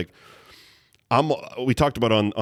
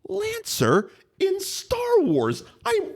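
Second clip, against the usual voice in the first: high, drawn-out fanboy outburst; retold excited yell; much slower than usual. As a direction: re-enact yelling at the TV in delight, pitched way up. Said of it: "I yelled at the T V".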